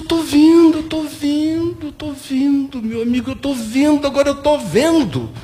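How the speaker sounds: imitated high, wailing insistence; tearful revelation. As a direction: repeat it high-pitched, overcome like the converted man. Acting man's tearful sudden revelation.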